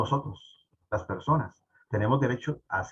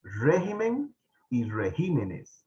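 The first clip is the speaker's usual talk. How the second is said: slow "Régimen y regímenes", RÉ then GÍ; "Régimen y regímenes" as a careful two-word model; contrasted stresses; slower than usual. Shown as a demonstration. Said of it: "se dice regímenes".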